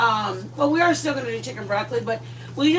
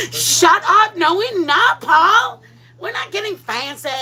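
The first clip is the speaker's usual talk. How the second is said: playful outrage; 6 semitones higher, 4 dB louder, slower than usual. Real, not acted. Reacting to Paul's chat comment.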